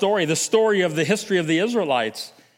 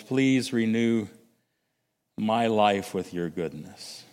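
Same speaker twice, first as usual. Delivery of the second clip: quiet prayer; 9 semitones lower, 4 dB quieter than usual. Acted. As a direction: pray it gently, as if alone with God. Reads a prayer to model.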